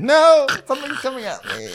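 very high squealed alarm; hammed-up helpless fright; mock panic. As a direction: squeal as if dodging a flying object. Acts panic at thrown object.

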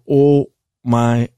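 slow, low, drawn-out shock; acted dumbfounded murmur to oneself; stunned disbelief. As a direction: let each word sink in, low and slow. Retells his shocked reaction afterwards.

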